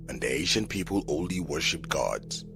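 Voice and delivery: ordinary delivery, nothing marked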